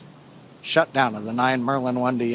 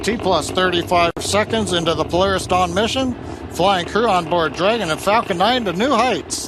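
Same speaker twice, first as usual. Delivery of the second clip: higher, louder thrilled commentary; excited launch call; 6 semitones higher, 6 dB louder than usual. Real, not acted. Host right after liftoff.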